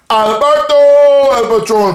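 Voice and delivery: high, loud, slow hype call; hyped announcement. Real, not acted. Vlogger hyping Alberto's entrance on Cinco de Mayo.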